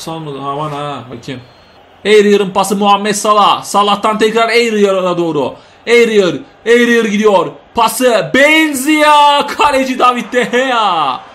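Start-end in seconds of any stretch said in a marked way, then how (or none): 2.0-11.2 lightly raised commentary calling the passes; play-by-play. Acted. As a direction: follow the ball name by name. Play-by-play of a passing move.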